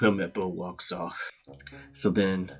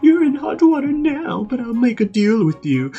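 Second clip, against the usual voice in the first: very high, loud whiny threat; a spoiled brat threatening a swordsman; shrill bratty threat; 6 dB louder than usual. Retold or imitated. Helmeppo threatening Zoro.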